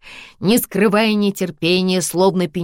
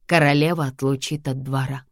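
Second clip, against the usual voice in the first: plain reading voice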